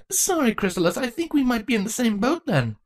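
put-on light, friendly young princess voice; sheepish and apologetic; young changeling girl. Draco's voice.

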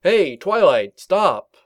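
loud, high call to stop; shouting. Acted. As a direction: yell after her to stop. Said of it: "With a cry of".

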